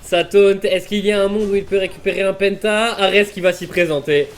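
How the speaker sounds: shouted, high-pitched hype call; esports caster. Real, not acted. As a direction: yell the pentakill to the crowd. Caster calls the pentakill.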